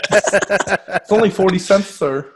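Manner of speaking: slow, drawn-out counter clerk pitch; upselling cashier. Pretend. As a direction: play a fast-food cashier pushing extras. Playing the restaurant cashier.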